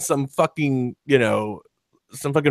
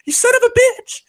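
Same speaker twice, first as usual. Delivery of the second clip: much higher, louder mock screaming girl; imitating a yell; 6 dB louder than usual. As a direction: shriek the insults like the girl in the video. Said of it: "when the girl was yelling".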